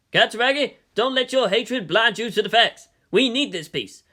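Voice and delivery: ordinary delivery, nothing marked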